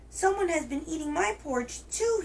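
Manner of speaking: put-on high squeaky baby bear; wee little voice. A narrator's reading. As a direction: pitch it way up, tiny and plaintive. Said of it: "he said in his wee little voice".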